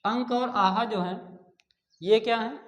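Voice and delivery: usual speaking voice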